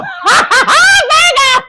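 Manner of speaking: a loud high cackle of a laugh; whooping laugh at her own joke; shrieking laugh. Real, not acted. Laughing shout after the joke.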